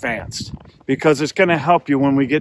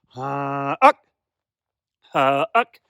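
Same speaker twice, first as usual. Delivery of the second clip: hummed huh-uck honk; goose honk; much slower than usual. Shown as a demonstration. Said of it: "that is a honk".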